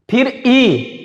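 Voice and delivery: ई sounded out clearly; teacher demonstrating the vowel ई; vowel ee demo. Shown as a demonstration. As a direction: sound out ई from the palate. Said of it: "ई बोलेंगे तो ई का उच्चारण स्थान तालु है".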